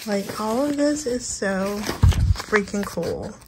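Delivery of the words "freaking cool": usual speaking voice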